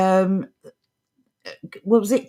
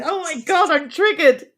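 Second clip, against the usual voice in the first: mock offended; 7 semitones higher than usual. Mocking offended snowflake.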